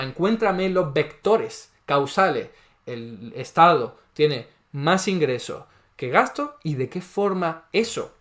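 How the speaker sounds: slow, deliberate challenge; slow demanding. Real, not acted. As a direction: spell out the demand slowly. Challenging opponent to explain.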